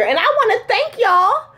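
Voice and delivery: high-pitched, playful proud thanks; gleeful bragging. Real, not acted. Thanking fans for thinking she looks young, gleeful. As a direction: gush your thanks, voice climbing high. Mock-grateful brag to the audience.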